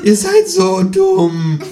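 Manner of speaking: very high, loud, slow squawk; croaking kangaroo. A narrator's reading. Kangaroo insulting the voters, high drawn-out croak. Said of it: "krächzt das Känguru".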